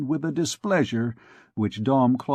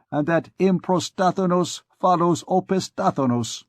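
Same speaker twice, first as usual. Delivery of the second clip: higher and heated, building indignation; heated argument; 7 semitones higher than usual. A narrator's reading. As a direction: argue it hotly, climbing in pitch. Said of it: "resumed Coictier hotly".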